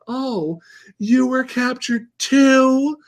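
put-on high, slow, wide-eyed girlish voice; little girl voice. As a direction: play a sweet captive girl, slowly. Voicing the captive girls.

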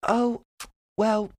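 high, slow, unbothered English lady; imitated British landlady, sweetly drawn out; calm British landlord. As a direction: deliver it calmly in a put-on British accent. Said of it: "She said it so calmly".

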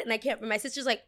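usual speaking voice

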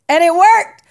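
high, loud, laughing punchline; laughing triumph. Real, not acted. To listeners, a loud laughing reveal. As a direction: burst out with it, delighted.

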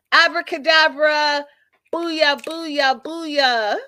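high, loud pretend spell; mock magic incantation. Host waving a pen as a wand, mock incantation.

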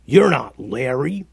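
lightly growled troll voice; a touch of puzzled troll growl, rising at the end. A narrator's reading. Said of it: "One of them piped up and growled".

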